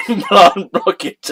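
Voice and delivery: "Implant blockage" cracking up mid-phrase; "Implant blockage" giggled out slowly; laughing. Real, not acted. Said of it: "can't say these words without laughing".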